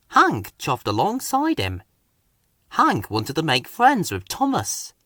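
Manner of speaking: ordinary delivery, nothing marked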